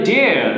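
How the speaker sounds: "dare" with no R at the end; silent final R. Shown as a demonstration. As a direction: let "dare" end on an open vowel, R silent. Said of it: "আমরা আর উচ্চারণ করবো না". ("dare" শব্দের শেষে র অনুচ্চারিত)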